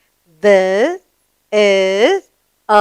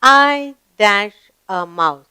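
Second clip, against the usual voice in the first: slow gapped reading; slower than usual. Shown as a demonstration. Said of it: "What does the mouse say?".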